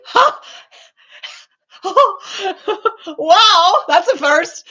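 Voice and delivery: bursts out laughing, loud and high; real delighted laugh. Real, not acted. A viewer said her name right, so she laughs out loud.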